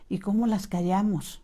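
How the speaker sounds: ordinary delivery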